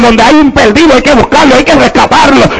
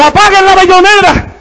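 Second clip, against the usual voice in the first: higher, mock cry to cut the music; half-acted shout from the bar; retold yell; 7 semitones higher than usual. Bar owner yelling to kill the jukebox, acted high. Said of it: "gritó".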